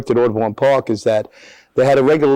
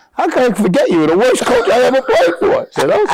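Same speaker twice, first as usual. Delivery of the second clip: high-pitched comic outburst; teasing insult; 13 semitones higher, 3 dB louder than usual. Retold or imitated. Player teases former coach on phone, high comic outrage. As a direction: rib the old coach with mock outrage.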